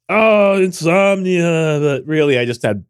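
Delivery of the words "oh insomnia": melodramatic, drawn-out sigh; mock-tragic young person; dramatic sigh. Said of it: "like all young people do".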